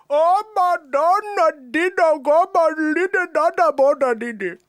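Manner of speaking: big Indian accent. Shown as a demonstration. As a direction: belt out the long singsong Indian-accent version. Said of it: "Or you could be like".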